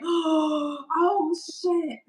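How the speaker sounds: high, drawn-out mock horror after a gasp; gasp, then let the alarm sink in; retold horrified realisation; shocked dread. Acting out shock at worst-case pictures.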